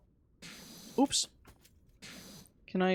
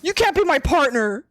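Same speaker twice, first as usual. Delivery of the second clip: loud, high yelling friend; mock angry yell; 10 semitones higher, 7 dB louder than usual. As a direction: snap at him in a hammed-up shout. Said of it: "He's like".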